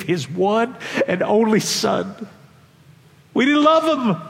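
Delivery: slow and building, peaking high and loud; climactic preaching. Acted. Preacher climaxing his sermon to the congregation with fervour. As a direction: declare it with rising fervour.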